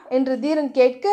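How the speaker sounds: plain reading voice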